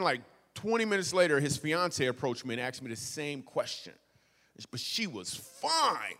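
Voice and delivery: usual speaking voice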